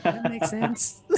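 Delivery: put-on very high squeaky baby creature; baby owlbear voice. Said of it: "my baby owlbear voice".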